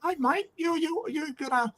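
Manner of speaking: high-pitched imitation of a friendly Maori man; big Maori guy. Big Maori guy coming over to greet a mate, high imitation. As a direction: put on a high Maori guy's voice. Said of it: "this big Maori guy who comes over".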